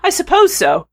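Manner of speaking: timid, unsure answer; tentative venturing. Acted. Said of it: "she ventured".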